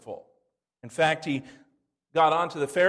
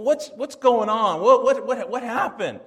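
urgent questioning; 3 semitones higher, 5 dB louder than usual. Retold or imitated. The preacher demanding answers from the fighting couple, loud and stumbling. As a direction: demand to know what happened.

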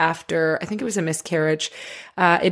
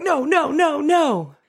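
high-pitched string of refusals; mock panicked denial; 7 semitones higher, slower than usual. Someone as a tear sneaks down, mock panicked denial. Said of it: "a little tear sneaks down".

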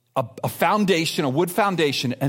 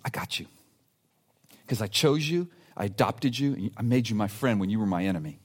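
tender voice of God; 8 semitones lower, 5 dB quieter than usual. Acted.

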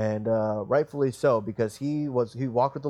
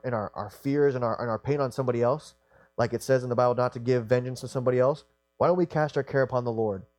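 ordinary talk

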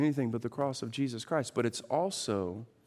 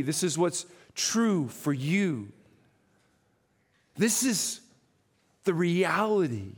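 full-force sermon delivery; fiery preaching; 9 semitones higher, 5 dB louder than usual. Acted. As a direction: preach it out to the whole room.

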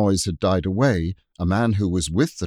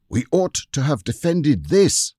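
raised, higher-pitched cry to companions; crying out in alarmed regret; urgent cry; 8 semitones higher, 4 dB louder than usual. A narrator's reading. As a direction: call it out urgently, pitched up high. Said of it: "he cried to the others".

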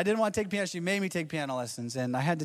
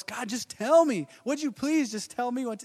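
pleading with God; 7 semitones higher, 3 dB louder than usual. Retold or imitated. Believers like Moses begging God for answers. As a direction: beg God to just say it. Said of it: "we're like Moses".

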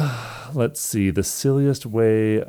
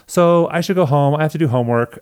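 higher-pitched mock date voice; retold excuse; 8 semitones higher than usual. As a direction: imitate a date making a polite excuse, higher. Retelling date making an excuse.

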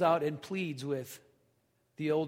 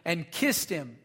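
lifted, louder Bible reading; scripture reading; 4 dB louder than usual. Acted. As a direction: read the passage out to the congregation. Reads parable aloud from scripture.

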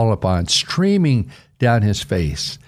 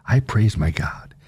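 whispered praise. Real, not acted. Preacher whispers his own praise to God, hushed. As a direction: whisper it like a private prayer.

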